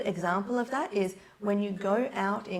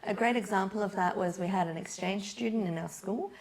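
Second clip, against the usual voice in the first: ordinary talk, nothing marked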